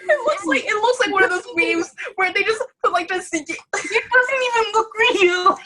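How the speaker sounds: high laugh-talk, near tears; gasping the words out between laughs. Real, not acted. Gamer laughing at a fake-looking scene, near tears.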